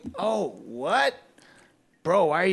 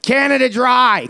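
slow, higher Canada Dry, like a proud slogan; drawn-out Canadian brag; 6 semitones higher, 6 dB louder, slower than usual. Acted. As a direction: stretch out Canada Dry slowly. Boasting he's Canadian.

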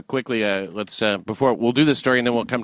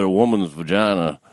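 put-on Southern accent; 2 semitones lower than usual. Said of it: "with Southern accent".

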